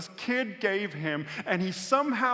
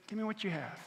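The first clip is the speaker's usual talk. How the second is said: hushed, understated request; gentle God; 8 dB quieter, faster than usual. Acted. God to a struggling couple, gentle and hushed. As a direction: imitate God speaking softly. Voices God looking at them.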